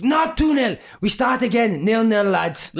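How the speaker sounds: high, declaring call to the team; mock announcement. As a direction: proclaim it like a ruling. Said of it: "he'd, like, make an announcement".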